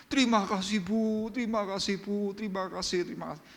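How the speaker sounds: a beggar thanking the kind woman again and again, high then fading; effusive thanks. Acted. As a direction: gush gratitude, dying away.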